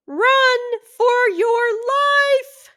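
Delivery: very high, loud, slow cry of alarm; panicked shout. Acted. City mouse warning the country mouse as a cat comes in, a high panicked shout. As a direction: scream the warning to save your friend. Cat, dog, person burst in.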